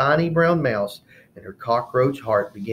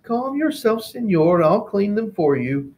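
Martina; 10 semitones higher, 4 dB louder than usual. Acted. Martina soothes pig.

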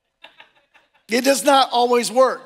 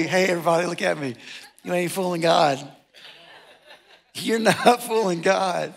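normal talking voice

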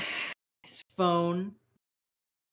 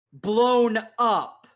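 "blown up" thrown back louder, with scorn; mocking quote; 7 semitones higher, 6 dB louder than usual. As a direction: quote his words back at him, sneering. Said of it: "and I quote".

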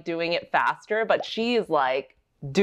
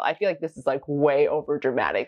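ordinary delivery

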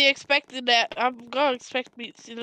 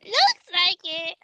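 slow, drawn-out nerd voice; nerdy voice; 4 semitones higher, slower than usual. Acted. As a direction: say it like a know-it-all nerd. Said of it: "Why do you say it in such, like, a nerdy way".

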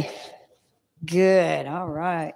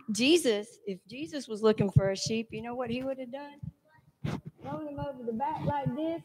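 hushed storytelling; 3 semitones higher, 12 dB quieter than usual. Acted. Hushed telling of Jesus story.

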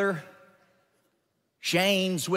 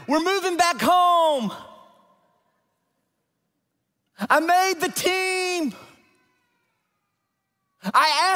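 joyful announcements; 9 semitones higher than usual. Acted. Acting out joyful life announcements.

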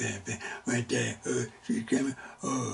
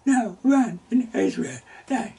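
loud, high preaching build; 8 semitones higher, 6 dB louder than usual. Acted. Preacher proclaiming faith to the church, loud and high. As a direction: drive the point home to the congregation.

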